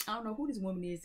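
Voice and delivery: a hammed-up puzzled dreamer. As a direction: repeat it pitched well up, playfully baffled. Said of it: "you watching this and you like".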